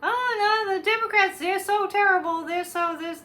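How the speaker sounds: high, loud mocking whine, easing off at the end; hammed-up whiny wail of complaint; whining and crying. Mocking Fox hosts complaining about the Democrats, whining. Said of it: "like, whining and crying".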